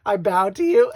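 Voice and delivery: loud mock vow with "bow" for "vow"; accented mispronunciation. Bride retelling what cracked her up, loud accented "bow". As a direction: belt out the groom's B in "bow". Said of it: "his English was, uh, a little more heavily accented".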